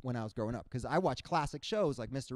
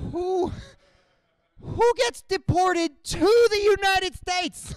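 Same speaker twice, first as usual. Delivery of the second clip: high, loud, slow outraged squawk; hammed-up disbelief, high and loud; mock indignant friend; 11 dB louder, much slower than usual.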